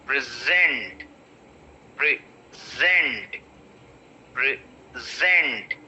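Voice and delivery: "present" as pri, pause, ZENT; short pri, then a long weighted ZENT in "present"; stress on second syllable. Shown as a demonstration. Teacher drills the verb, second-syllable stress on "present". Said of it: "Second syllable is getting stressed".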